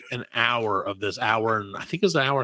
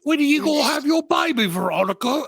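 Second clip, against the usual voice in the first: mock [name]; 12 semitones higher than usual. Friend mocking the movie's pregnant Veronica scene, high mock shout. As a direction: shout it up high like a gushing pop star. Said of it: "they're like".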